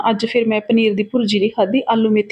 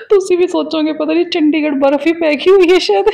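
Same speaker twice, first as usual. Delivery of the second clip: laughing; 5 semitones higher, 3 dB louder than usual. Real, not acted. Joking about viewers' thoughts.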